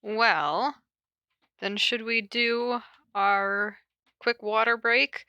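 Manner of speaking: ordinary delivery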